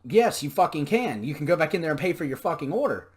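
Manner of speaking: loud fast furious comeback; snapping back at her; angry retort. Retold or imitated. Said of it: "I was like".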